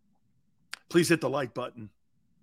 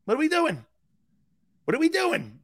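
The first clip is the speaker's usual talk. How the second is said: high, loud teammate's voice calling out; imitating Jerome Brown; 12 semitones higher, 3 dB louder than usual. To the gym crew, Jerome asking loudly what's going on. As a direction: squeak out "What are we doing?" as the big man strolling in. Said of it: "He comes walking in".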